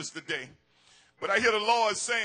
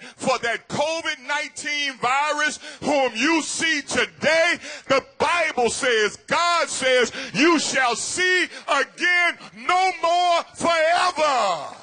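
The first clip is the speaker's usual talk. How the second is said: rising to a loud, slow preached shout; climbing sermon cry; preaching at full force; 4 semitones higher, 4 dB louder than usual. Acted. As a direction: build the crowd up to the promise.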